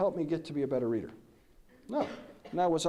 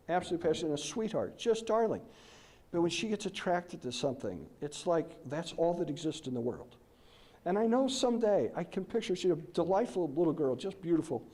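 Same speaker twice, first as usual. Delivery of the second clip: ordinary talk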